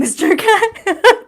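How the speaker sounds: high, drawn-out, laughing read. Real, not acted. Reading Katie's teasing question aloud, laughing.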